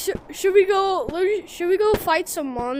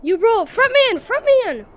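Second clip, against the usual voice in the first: loud urgent call, higher; loud sharp voice; 6 semitones higher, 6 dB louder than usual. Real, not acted. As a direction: push him forward, sharply. Urging friend to the front.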